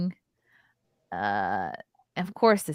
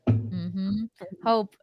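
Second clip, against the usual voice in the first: ordinary talk, nothing marked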